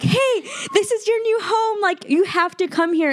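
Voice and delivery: high-pitched imitation of God calling out; playful, urgent retold yell; mock yell. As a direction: call it out high without real volume. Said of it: "like, God yelling".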